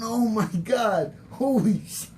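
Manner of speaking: startled and embarrassed on the stairs; shocked exclamation. Retold or imitated. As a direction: blurt it out, caught off guard. Retelling his shock at recognition.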